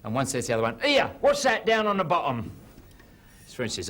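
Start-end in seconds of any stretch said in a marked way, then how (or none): 0.8-2.5 high, loud Cockney whale voice; a cheeky Cockney whale calling out; hint of a Cockney whale. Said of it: "two Cockney whales".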